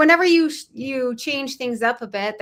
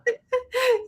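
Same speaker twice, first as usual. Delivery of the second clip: high squealing laugh; 14 semitones higher than usual. Real, not acted. Reacting to poem twist.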